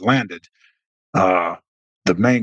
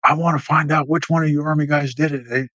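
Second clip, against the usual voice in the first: angry officer; 7 semitones higher than usual. Retold or imitated. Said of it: "Harold was mad".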